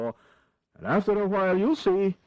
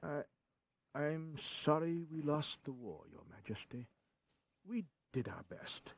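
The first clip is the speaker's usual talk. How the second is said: much softer, subdued and humble; meek apology; 3 semitones lower, 13 dB quieter than usual. Acted. Minister apologises to the king for the lost war, softly. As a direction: hang your head before the king.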